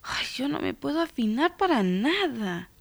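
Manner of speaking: put-on high, soft child's voice lamenting; girl's lament. A narrator's reading. Girl despairs of singing in tune, soft high lament. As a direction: sigh it out as a discouraged girl, high and small.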